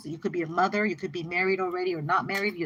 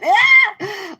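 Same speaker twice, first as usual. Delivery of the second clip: short high loud yelp of disgust; sudden loud eek; creeped-out shriek; 13 semitones higher, 12 dB louder than usual. Real, not acted. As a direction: shriek as if something crawled on you. Shudders at creepy energy.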